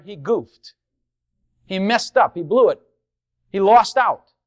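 normal talking voice

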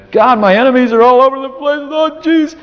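anguished cry to God. Retold or imitated. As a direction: cry out to God, cornered by enemies. David crying out in Psalms.

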